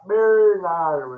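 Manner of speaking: a long, drawn-out call of Bernardo. Acted. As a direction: call the boy from afar. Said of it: "quando for chamar".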